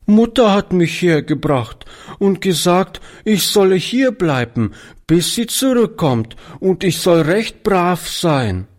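leicht weinerliche Kinderstimme, etwas höher; schluchzend. A narrator's reading. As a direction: ein Hauch von Schluchzen, gegen Ende langsamer. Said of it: "erklärte die Kleine schluchzend". (kleines Mädchen erklärt dem Alten, leicht schluchzend)

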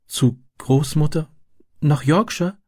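high, slow, delighted disbelief; light up through the tears. A narrator's reading. Girl hears she will go to grandmother, delighted. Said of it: "wiederholte sie erfreut".

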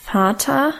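"Vater" stretched long, rising at the end; slow, cosy "Vater" with a high rising end; drawn out, cosy. A narrator's reading. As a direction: draw out "Vater" in a leisurely questioning lift. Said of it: "sagte so recht gedehnt, gemütlich".

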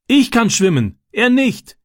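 put-on high, urgent boy's reply across water; calling back. A narrator's reading. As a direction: call back with the voice pitched high. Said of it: "rief Francis zurück". (hoch zurückrufend)

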